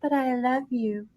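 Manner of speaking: soft, quiet pleading; whisper-close devotion, understated; quietly mock-tender confession; lightly voiced sappy dragon. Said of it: "he goes".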